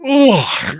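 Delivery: high drawn-out "ugh"; retold groan of revulsion; disgusted groan. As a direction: recoil with a high, imitated groan. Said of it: "he was like".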